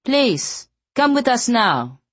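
put-on high, insistent Afrikaans accent; stern Afrikaans accent. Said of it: "had an Afrikaans accent".

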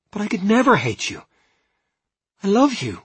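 high, loud, earnest declaration; fervent reassurance. A narrator's reading. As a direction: insist on it with pitch soaring. Alice fervently reassures her fiancé.

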